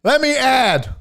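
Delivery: high, loud butting-in shout; imagined drunk heckler. Pretend. Pushy stranger interrupts an accountant at work, high hollering. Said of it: "Nobody goes up to an accountant".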